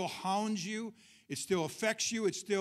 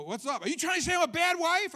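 high, loud mock outburst of an offended wife; imitating screaming wife; 11 semitones higher, 6 dB louder than usual. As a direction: play the wife flaring up at a simple question. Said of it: "the wife just goes crazy, starts screaming at you".

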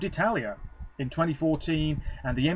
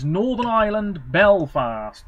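announcing; 5 semitones higher, 7 dB louder, slower than usual. Real, not acted. Announcing next host city.

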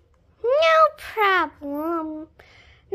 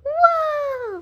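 plain reading voice

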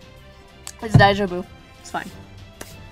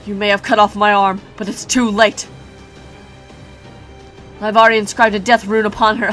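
gloating giant; 6 dB louder than usual. Acted.

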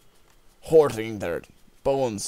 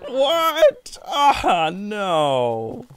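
panicked shout; 14 semitones higher, 14 dB louder, much slower than usual. Real, not acted. Panicked surprise in game.

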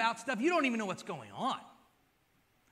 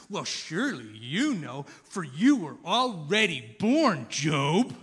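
super sarcastic; 4 semitones lower, 7 dB louder, slower than usual. Retold or imitated. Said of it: "getting super sarcastic now".

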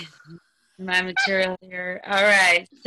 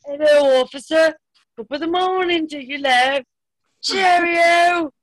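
higher-pitched put-on British accent; 6 semitones higher, 4 dB louder than usual. Shown as a demonstration. Comic greeting the officer to dodge a DUI, British accent. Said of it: "what you gotta do is pursue my British accent".

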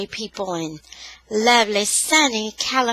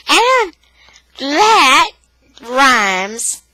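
playful excitement; 4 semitones higher, 6 dB louder, much slower than usual. Real, not acted. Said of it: "remarks on her rhyme".

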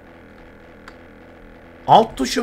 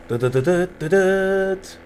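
imitated engine noise; 4 dB quieter, slower than usual. Imitating helicopter sound.

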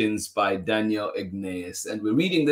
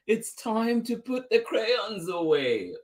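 high teacher's call at normal volume; hurrying teacher; 11 semitones higher than usual. A narrator's reading. Teacher ending Tisha's drawing, high-pitched call. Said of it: "said the teacher".

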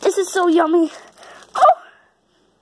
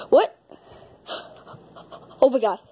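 ordinary delivery

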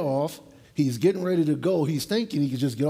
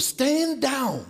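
sharp, much higher mock order; retold command; 9 semitones higher than usual. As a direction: bark it like the order given to the officials. Officials ordered to stand down.

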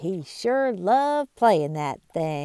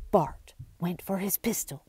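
plain reading voice

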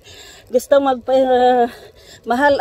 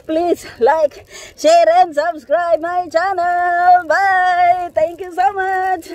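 excited outro; 9 semitones higher, 9 dB louder, much slower than usual. Real, not acted. Channel outro sign-off.

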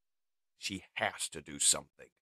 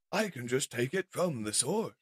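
higher-pitched villain threat; menacing mad scientist; 6 semitones higher than usual. Acted. Mad scientist puts device before captive's optic, menacing. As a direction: relish the threat.

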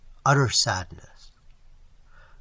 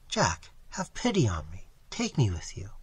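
soft, lower, pleading; hushed plea; 4 semitones lower, 6 dB quieter than usual. A narrator's reading. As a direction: beg him softly to take you along. Girl begs to go along.

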